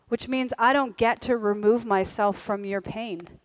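tender humble voice of the heart; humble and sincere. Retold or imitated. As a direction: speak gently, owning their hurt. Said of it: "heart posture that says".

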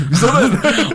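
high, loud, squeaked through laughter; laughing mock quote. Repeats his quote while laughing.

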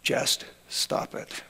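slow, flat near-whisper; dry and hushed, no feeling; deadpan. Retold or imitated. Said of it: "He was a deadpan guy".

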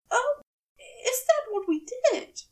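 high, squeaky question; squeaking. A narrator's reading. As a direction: squeak it out in surprise. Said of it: "he squeaks".

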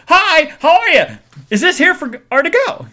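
high, loud sing-song greeting; bright pushy waitress sing-song; chirpy server voice. Retold or imitated. Server greeting customers at the counter, high sing-song. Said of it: "another guy goes".

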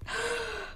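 sharp high gasp of hurt. Real, not acted. Vlogger recalling a book's last words, sharp gasp. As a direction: catch your breath as if stabbed.

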